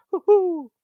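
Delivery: high, drawn-out cheer; playful whoop. Real, not acted. Host greets a chat member, high playful whoop. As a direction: let out a light woo-hoo.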